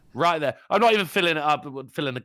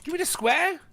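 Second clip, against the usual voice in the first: mock disbelief. Real, not acted.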